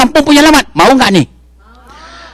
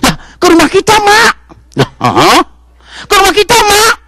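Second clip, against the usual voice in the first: put-on high little child calling to mom; child's voice; 12 semitones higher than usual. Child tells his mother the ambulance came home, high. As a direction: squeak it out like a small kid.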